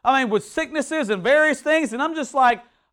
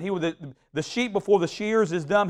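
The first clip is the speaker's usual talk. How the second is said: usual speaking voice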